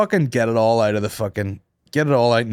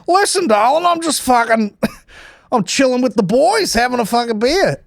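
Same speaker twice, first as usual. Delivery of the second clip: high mock chilled-out bloke voice; laid-back bloke; 11 semitones higher than usual. Podcaster imitating a husband brushing off his wife, mock laid-back. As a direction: brush her off lazily in a pitched-up put-on.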